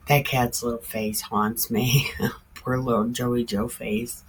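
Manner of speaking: usual speaking voice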